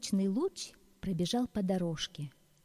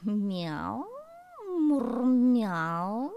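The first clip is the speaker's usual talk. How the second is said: meowing purr; purring cat; 4 semitones higher, 5 dB louder, much slower than usual. Acted. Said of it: "замурлыкала кошка". (мурлыканье кошки)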